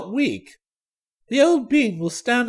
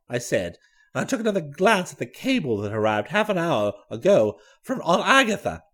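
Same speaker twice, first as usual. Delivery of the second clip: plain reading voice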